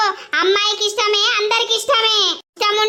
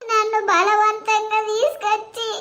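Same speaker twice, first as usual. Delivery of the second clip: tearful crying; 2 semitones higher, 4 dB quieter, slower than usual. Acted. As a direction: sob it out, broken by the forced wedding. Bride forced into marriage, weeping.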